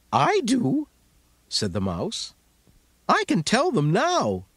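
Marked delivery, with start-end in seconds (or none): 0.1-0.9 much higher, small mouse voice; squeaky mouse voice. Acted. Mouse speaks in story. 3.1-4.5 much higher, small mouse voice; squeaky mouse voice. Acted. Mouse speaks in story.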